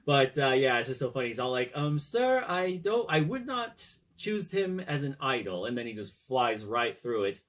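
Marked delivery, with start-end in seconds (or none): 1.7-5.7 quoting Jarvis. Retold or imitated.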